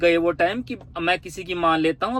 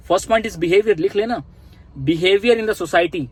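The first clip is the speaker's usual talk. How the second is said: ordinary talk, nothing marked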